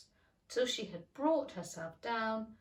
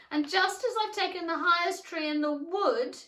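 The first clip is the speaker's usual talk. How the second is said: higher, louder fretting bird; agitated pigeon; 8 semitones higher, 9 dB louder than usual. A narrator's reading. Pigeon venting to Alice, higher and louder. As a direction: build the grievance.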